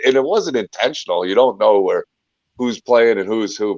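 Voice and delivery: usual speaking voice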